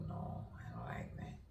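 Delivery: very soft, near-whispered imitation; hushed quote. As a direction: whisper it as someone else's objection. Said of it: "They go".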